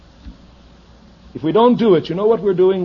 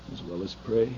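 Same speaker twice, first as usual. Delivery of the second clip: much softer and lower; quiet close; 6 semitones lower, 9 dB quieter than usual. Real, not acted. Preacher finishing the command, softly. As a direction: drop almost to a hush. Soft after shouting.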